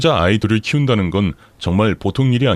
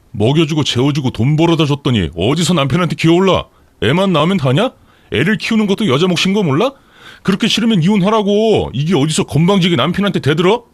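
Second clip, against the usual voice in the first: acted tirade climbing in pitch; indignant, high-pitched scolding; angry husband; 7 semitones higher than usual. A narrator's reading. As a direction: berate the wife in a heated quarrel. Husband's furious quarrel, demanding divorce.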